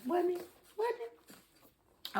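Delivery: teasing. Real, not acted. Teasing viewers about the scene.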